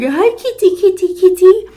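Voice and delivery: very high cooing call; baby talk to cats. Retold or imitated. Old woman calling the kittens, very high cooing. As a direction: coo like a delighted child. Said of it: "That's what she was doing".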